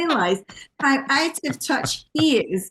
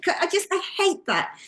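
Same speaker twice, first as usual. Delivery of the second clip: normal talking voice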